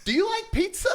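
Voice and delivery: high, loud, laughing through the question; laughing quote. Retold or imitated. Retelling the singer's pizza invite, breaking into laughter. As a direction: crack up while asking it. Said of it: "laughing through singer's line".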